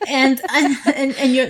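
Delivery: ordinary talk, nothing marked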